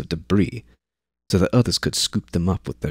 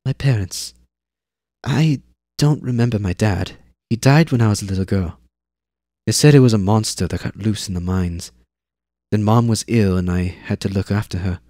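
put-on matter-of-fact girl; 2 semitones higher, 3 dB louder than usual. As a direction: tell it like a kid reciting facts. Girl tells family story.

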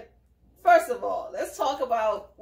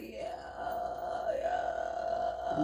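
much softer, slurred yeah; mock drunk mumble; 10 dB quieter than usual. Asked about his marriage, a soft drunken mumble. Imitating the drunk husband's reply.

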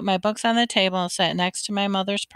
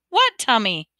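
slightly raised, incredulous question; mild shout; 3 semitones higher, 4 dB louder than usual. A narrator's reading. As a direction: half-shout it at a little brother. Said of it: "I shouted at my brother".

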